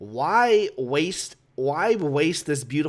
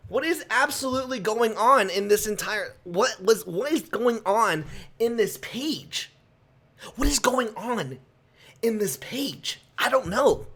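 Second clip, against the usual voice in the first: exasperated bewilderment; 6 semitones higher than usual. Real, not acted. Baffled rant about confusing page.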